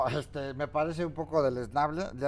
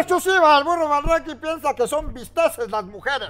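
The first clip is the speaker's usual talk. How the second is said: higher, louder, hammed-up gossip anchor announcement; mock news flash; 11 semitones higher, 6 dB louder than usual. Mocking the host with a fake news flash, breathless gossip-anchor voice. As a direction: announce it like a scandal headline.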